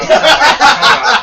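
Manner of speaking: shouted, laughing time out; loud call. Real, not acted. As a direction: call out over everyone, laughing. Calling a halt mid-story.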